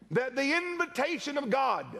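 usual speaking voice

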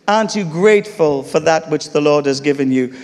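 ordinary talk, nothing marked

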